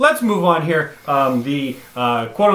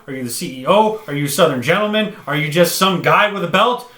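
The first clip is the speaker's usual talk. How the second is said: ordinary talk, nothing marked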